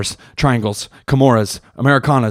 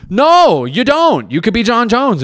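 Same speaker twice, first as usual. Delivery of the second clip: emphatic rebuttal; 8 semitones higher, slower than usual. Acted. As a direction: cut the excuse down, voice jumping up. Acted rebuttal to losers' excuse.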